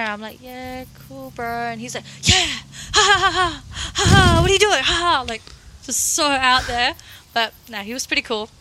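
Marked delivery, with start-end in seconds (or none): 0.4-1.5 soft, low-key mumbled reply; low energy. Retold or imitated. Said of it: "Mine was down here". 2.2-5.2 loud, high whooping laugh, imitating him; over-the-top high energy. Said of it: "his energy level was up here".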